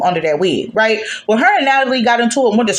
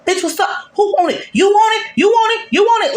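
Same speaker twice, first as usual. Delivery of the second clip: wild fight challenge; 10 semitones higher than usual. Retold or imitated. Woman on the table taunting the other girls, mock shouting. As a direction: yell it like you're ready to brawl. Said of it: "acting like a wild ass bitch".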